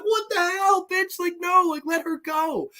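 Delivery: very high, squeaky plea; hammed-up high-pitched alarm; mock incredulous protest. Acts man's shocked protest.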